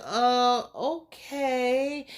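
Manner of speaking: skeptical, lingering acknowledgment; doubtful okay. Acted. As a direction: stretch it out as if unconvinced. Acts Angela's doubtful response.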